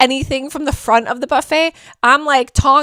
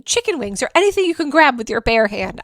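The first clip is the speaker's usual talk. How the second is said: ordinary talk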